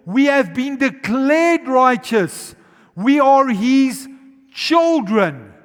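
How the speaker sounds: loud, high, slow declaration; thundering sermon climax; preaching at full force. Acted. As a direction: proclaim it to the whole church.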